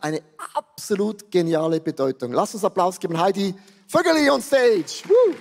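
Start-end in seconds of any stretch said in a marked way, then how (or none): 5.1-5.3 Jubelruf. Real, not acted. Applaus für Heidi.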